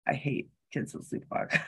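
breathy whisper of dread; a hushed creeped-out aside. Real, not acted. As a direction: whisper it like a confession. Confessing fear of sleepwalkers.

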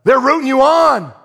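high, loud pulpit delivery; impassioned preaching. Real, not acted. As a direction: preach it with building fervour. Preaches at full force.

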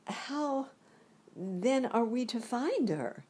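put-on high puzzled girl; a girl asking the guard how; lifted girlish voice; Dorothy's voice. Dorothy's question, quoted in the story.

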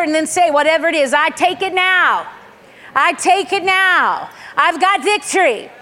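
building from firm call to high, loud shout; rallying declaration. Real, not acted. Preacher leading congregation to receive, building declaration. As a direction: lead the crowd to claim it, stretching the words.

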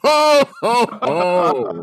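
loud, high mock Santa ho-ho; Santa laugh. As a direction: boom it out as a jolly Santa. Co-host plays Santa.

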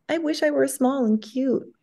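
high, small cutesy voice; high, plaintive acted longing; wistful little wish. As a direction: pitch it up like a wishing child.

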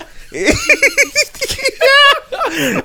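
squeaky laughing fit; high cracking laugh. Real, not acted.

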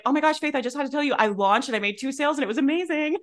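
high, bubbly, gushing news; mock-giddy report of two sales; excited client. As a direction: imitate a thrilled client gushing. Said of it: "they'll be like".

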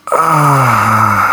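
loud crying ah demo; loud cry into mic. Shown as a demonstration. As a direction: wail ah into the microphone. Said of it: "go up to the microphone and go".

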